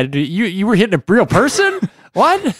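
high, loud, laughing disbelief; surprised laughing. Real, not acted. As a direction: shriek out the what in laughing shock. Laughing disbelief at robot hitting.

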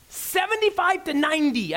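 high, incredulous repeat of Seventy-five to ninety; astonished repeat. Real, not acted. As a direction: let the numbers land in disbelief. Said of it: "next one's gonna stagger you".

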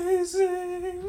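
mock-amazed, high and slow; sarcastic high drawn-out. Real, not acted. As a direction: stretch it out sarcastically. Sarcastic reply mid-argument.

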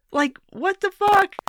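outraged squeal at the hospital claim; incredulous. Real, not acted. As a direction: cry it out in stunned disbelief. Outraged at Bernie's defense.

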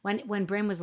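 ordinary delivery

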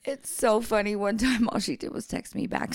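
normal talking voice

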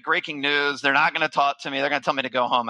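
ordinary delivery

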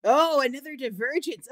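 usual speaking voice

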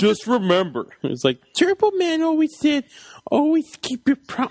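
high, put-on child voice; imitated earnest kid. Co-host as kid quoting Turbo Man, mock-earnest child. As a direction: recite the hero's motto like a devoted little fan.

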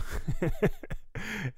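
high, quick laugh. Real, not acted. Comedian laughing at his own image, high. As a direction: chuckle at the picture he painted.

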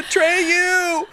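high, loud hammed-up wail of the name; mock anguished cry. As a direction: cry out the hero's name as in the film. Quoting Neverending Story swamp scene.

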